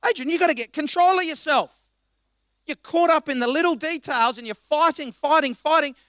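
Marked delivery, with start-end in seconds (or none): 0.1-4.4 self-scolding. Real, not acted. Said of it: "I wanna say that to us, to myself".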